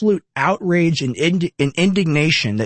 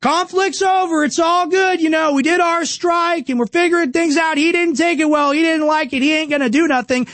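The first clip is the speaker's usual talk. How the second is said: mock neocon; 11 semitones higher than usual. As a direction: ham up the dismissive warmonger. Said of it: "walk away and say".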